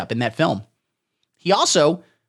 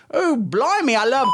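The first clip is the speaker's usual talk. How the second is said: high, bright-eyed British character voice, much higher; put-on British voice; 9 semitones higher than usual. Host finally giving in and doing the British character voice. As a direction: pop into a silly Cockney-ish voice. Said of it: "I'll do the voice".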